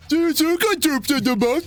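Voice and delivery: high, mocking parrot of the game line; mocking game character. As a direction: throw the line back in a high mock voice. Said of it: "Shut up".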